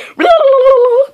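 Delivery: loud, very high rolling vocal noise; imitated noise. Shown as a demonstration. Shows what it can do.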